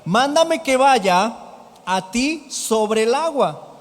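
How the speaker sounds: slow, projected request; Peter calling out. Acted. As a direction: call it out word by word. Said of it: "respondió Pedro".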